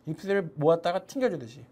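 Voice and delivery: ordinary talk, nothing marked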